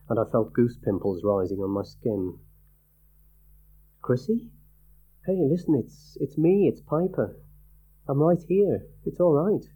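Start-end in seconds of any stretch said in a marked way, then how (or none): 4.0-9.6 calm her down tenderly; gentle reassurance. A narrator's reading. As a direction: narrator coaxing a friend out of a nightmare. Soothing a frightened friend.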